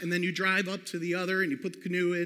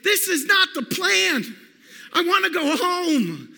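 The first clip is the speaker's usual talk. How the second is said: losing it; 9 semitones higher, 6 dB louder than usual. Retold or imitated. Kid in the hailstorm shouts to his parents that he wants to go home. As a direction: whine like a scared boy who wants out. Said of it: "that's where I'm like".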